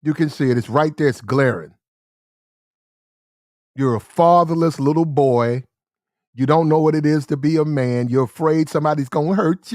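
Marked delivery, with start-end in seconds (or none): none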